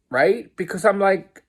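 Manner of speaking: usual speaking voice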